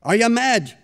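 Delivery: high, loud angry shout. Acted. Mistress shouting at maid back from butcher, angry shout. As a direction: bark it at the late maid. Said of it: "Missus Shaughnessy shouted at her".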